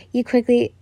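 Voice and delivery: ordinary delivery, nothing marked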